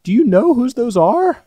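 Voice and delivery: very high, slow, loud creepy question; imitating a creepy stranger; high creepy lady. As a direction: needle him like a possessed stranger. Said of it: "And she's just like".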